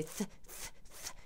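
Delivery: "th" as soft, unvoiced puffs; isolated th sound. Shown as a demonstration. Swede showing the saliva-making sound, voiceless "th". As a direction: push air over the tongue for a bare "th". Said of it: "this sound does".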